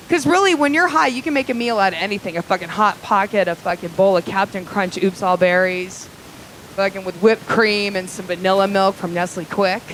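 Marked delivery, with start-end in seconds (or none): none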